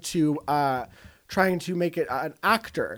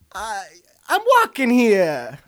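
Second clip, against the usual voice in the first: bad, slow New York-ish Boston accent; botched Boston accent, slow and loud; 3 semitones higher, 4 dB louder, slower than usual. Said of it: "Let's hear your Boston accent".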